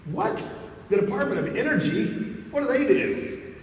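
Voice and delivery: high-pitched, incredulous what; shocked disbelief. Retold or imitated. Speaker reacting to eighty thousand staff, high and incredulous. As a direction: squeak out your outrage at the number.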